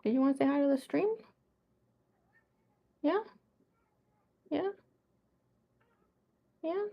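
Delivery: quick, high cooing question to a cat; pet talk. Real, not acted. Streamer coaxing her cat to greet viewers, fast high pet talk.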